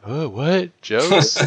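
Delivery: slow, drawn-out puzzled "what jokes"; mock blank thinking. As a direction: play dumb, stretching it out.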